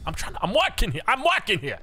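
put-on New York cabbie line in a broad Aussie accent. Streamer yelling back at YouTube in an Aussie accent. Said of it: "that's my Aussie accent".

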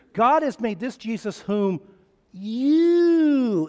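"you" pushed high and loud; stress on you. Shown as a demonstration. Preacher stressing "you" to the crowd, loud and high. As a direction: hammer the word "you". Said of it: "in the Greek, that word "you" is the emphasis".

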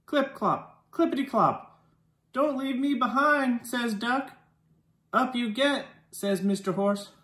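usual speaking voice

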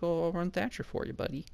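badly faked Canadian accent sliding into Indian. Offering a teammate help in the game, bad Canadian accent. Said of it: "I tried doing a Canadian accent and it turned into a shitty Indian accent".

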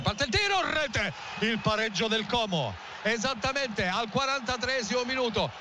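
excited goal call. Real, not acted. Equaliser scored, commentator erupts.

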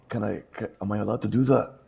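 ordinary delivery

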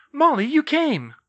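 high, loud, glad exclamation; glad greeting. A narrator's reading. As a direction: call out a surprised welcome. Greeting an arriving guest.

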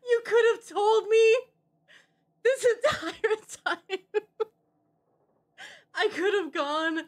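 half laughing, half crying. Real, not acted. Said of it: "I'm actually crying".

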